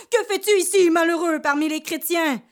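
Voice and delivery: loud, high, thundering rebuke. Acted. Priest confronting the stranger at the ball, thundering rebuke. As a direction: thunder at the devil from the pulpit. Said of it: "d'une voix tonnante".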